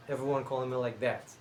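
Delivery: usual speaking voice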